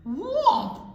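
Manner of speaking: high, loud crash sound; loud whomp. Acted. Narrator voicing the falling tree, a loud whomp. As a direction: slam the crash word out.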